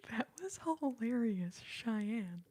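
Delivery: amused, words coming out through laughter; laughing. Real, not acted. Streamer telling a viewer the joke landed, laughing. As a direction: say it while still giggling.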